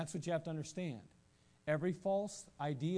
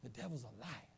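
soft reply; 5 semitones lower, 9 dB quieter than usual. Retold or imitated. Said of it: "I'd say".

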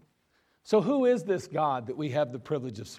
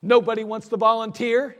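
loud, higher irritated sergeant; getting mad; 5 semitones higher, 8 dB louder than usual. Retold or imitated. Sergeant scolding the platoon for not volunteering, irritated and loud. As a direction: let the anger rise as nobody steps up. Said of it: "He's getting mad".

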